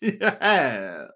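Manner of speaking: loud, gloating villain laugh; a triumphant sphinx chuckle; sinister laugh. A narrator's reading. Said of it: "he says".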